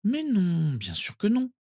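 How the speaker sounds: put-on high, tender girl soothing a child; reassuring. A narrator's reading. Narcissa stroking little cousin's curls, reassuring. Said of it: "le rassura Narcissa".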